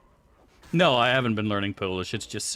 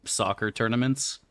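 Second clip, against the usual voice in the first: usual speaking voice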